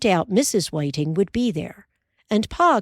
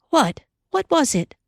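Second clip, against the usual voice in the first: higher, anxious questions; alarmed; 9 semitones higher than usual. Acted. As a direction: fear creeping in.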